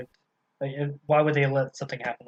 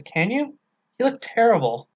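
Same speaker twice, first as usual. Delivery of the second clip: mock taunting; 8 semitones higher, 4 dB louder than usual. Jaime mocking Catelyn's looks.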